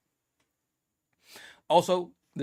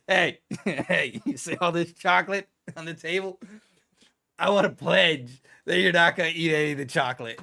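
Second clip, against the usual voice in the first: louder, mock coaxing voice for a child; talking to a kid; 5 dB louder than usual. Mocking a parent telling a kid not to eat chocolate, coaxing voice. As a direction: lecture a kid about not eating chocolate, with a laugh. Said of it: "It's like telling a kid".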